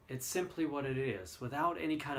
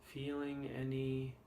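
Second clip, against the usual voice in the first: guided meditation; 6 dB quieter than usual. Acted. Leads the body scan meditation.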